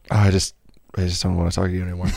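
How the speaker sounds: low-pitched, mock-weary brush-off; hammed-up low, reluctant reply; put-off listener. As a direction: drop the voice low to back out of talking. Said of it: "And you're like".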